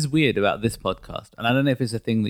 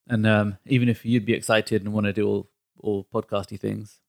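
ordinary delivery

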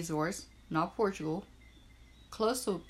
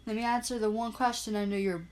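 usual speaking voice

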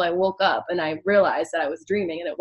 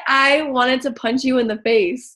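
retold outburst, higher and louder; mock exasperation; 5 semitones higher, 6 dB louder than usual. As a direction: quote the roommate's indignant complaint. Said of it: "She was like".

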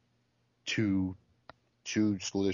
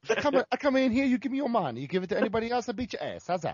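put-on Italian mobster accent; 13 semitones higher, 4 dB louder than usual. Continuing Italian mobster bit.

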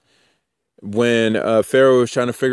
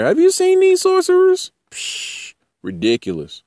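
mock squeal. Mocking Israelites in Egypt.

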